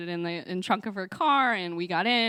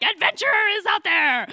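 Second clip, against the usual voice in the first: put-on high, loud kid's rallying cry; excited shout; 13 semitones higher, 9 dB louder than usual. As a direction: shout it like setting off on an adventure. Kid shouting excited adventure.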